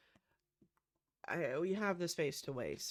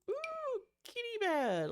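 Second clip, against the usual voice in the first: high, bright squeal of delight over a find; excited squeal; 7 dB louder, slower than usual. Real, not acted. Sims builder spotting a kitty bed, delighted squeal. Spots a cat bed in catalogue.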